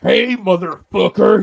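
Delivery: loud, high, drawn-out holler; drunk outburst. Retold or imitated. Acting out drunk friend's outburst.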